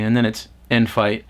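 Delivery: ordinary talk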